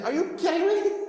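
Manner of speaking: high, laughing disbelief; a Moses laughing in disbelief at his people; incredulous. Acted. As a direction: scoff at the very idea, pitched high.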